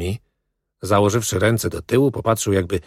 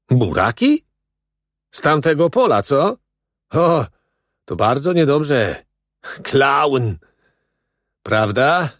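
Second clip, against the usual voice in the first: higher-pitched questioning foreman; raised, exclamatory interrogation; 6 semitones higher, 3 dB louder than usual. A narrator's reading. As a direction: act a foreman catching someone out. Acted foreman questioning prisoners.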